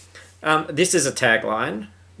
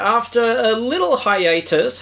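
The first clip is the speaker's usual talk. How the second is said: excited welcome; 12 semitones higher, slower than usual. Real, not acted. Excited high show return.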